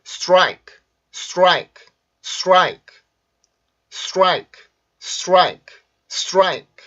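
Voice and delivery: crisp one-syllable "strike", falling; English pronunciation model. Shown as a demonstration. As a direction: model "strike" with rounded tr after the s. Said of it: "자, 한번 소리 들어보세요".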